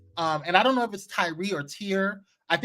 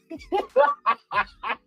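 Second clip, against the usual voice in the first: high cackling burst of laughter; shrieking laugh; 13 semitones higher than usual. Real, not acted. Host cracking up at a viewer comment, high shrieking laugh.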